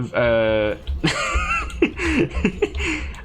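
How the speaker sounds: high breathy giggle breaking up the line; helpless high-pitched giggling; giggling fit. Real, not acted. Cracks up mid-quote.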